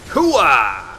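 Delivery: loud grunting war cry; battle cry. Real, not acted. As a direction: bark it as you strike.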